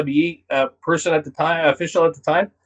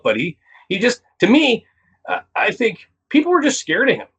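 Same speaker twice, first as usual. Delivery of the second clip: normal talking voice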